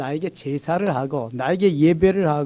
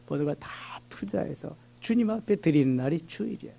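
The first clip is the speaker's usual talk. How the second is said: whisper; 8 dB quieter than usual. Real, not acted. Sermon climax dropped to whisper.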